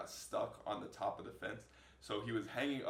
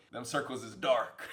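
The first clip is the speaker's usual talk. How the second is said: laughing; 5 dB louder than usual. Real, not acted. Mocking his own dark eyes.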